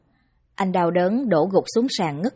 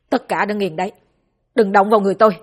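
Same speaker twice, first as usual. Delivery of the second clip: defiant shout; 6 dB louder, faster than usual. Acted. Son defies the servants.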